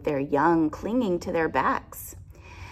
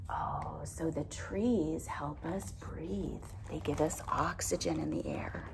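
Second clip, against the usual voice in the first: quiet aside; 2 semitones lower, 8 dB quieter than usual. Real, not acted. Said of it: "quiet aside to children".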